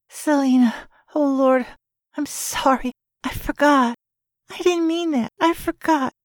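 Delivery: high, pleading, stricken apology; distressed apology. Acted. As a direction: beg forgiveness, choking back panic. Jalen apologizing after choking sound.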